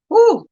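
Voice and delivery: loud high whoop of disbelief; high woo. Real, not acted. Remembering the parking bill, a high loud whoop. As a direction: whoop it out again. Still reacting to parking prices.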